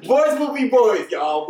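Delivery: high laughing sing-song. Real, not acted. Friend teasing the hosts, laughing high.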